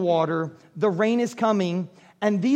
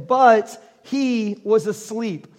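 slow, drawn-out scripture reading; measured scripture read; much slower than usual. Shown as a demonstration. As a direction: read it plainly and slowly. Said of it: "I love how there's, like, a colon there".

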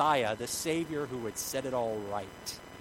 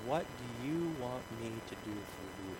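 softer, lower, unhurried asking; gentle question; 4 semitones lower, 8 dB quieter than usual. Acted. Jesus asking blind Bartimaeus, gentle and quiet. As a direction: ask with quiet care.

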